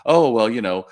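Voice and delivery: acting out a glib thought; casual wishful thinking. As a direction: voice someone's easy assumption. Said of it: "don't start off by thinking".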